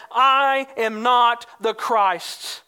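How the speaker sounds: "I am not the Christ" slow and firm, long weight on "I"; strong denial. Retold or imitated. John answers the priests, a slow, firm denial. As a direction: lean hard on "I" and "not", words spaced out. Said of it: "it shows strong wording".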